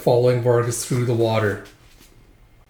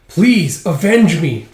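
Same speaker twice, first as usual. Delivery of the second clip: higher, louder acted plea; mildly dramatic appeal; pleading; 5 semitones higher, 7 dB louder, much slower than usual. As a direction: beg with understated desperation. Prisoner begs for revenge.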